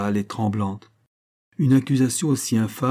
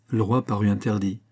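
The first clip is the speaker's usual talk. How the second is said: usual speaking voice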